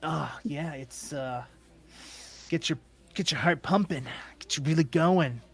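lightly voiced sleazy come-on; a hint of an eager gambler on the make; flirting gambler. Game master plays flirting gambler.

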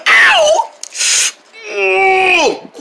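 high, loud cry of pain; high shout of hurt; loud pained yelp. Acted. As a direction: yelp as the finger smarts. Punched a crate again.

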